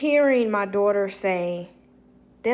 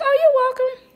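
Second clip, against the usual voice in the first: much higher and louder, a squeaky bright reply; high sing-song welcome; 8 dB louder than usual. Retold or imitated. Answering the grateful husband in a high sing-song. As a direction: chirp it sweetly and high.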